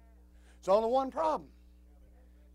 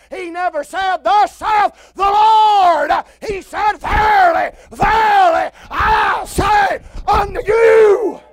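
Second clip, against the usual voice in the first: loud, rising sermon holler; full-force preaching; 12 semitones higher, 17 dB louder than usual. Acted. Preacher thundering at his congregation, full-force holler. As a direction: preach it hard and high to the pews.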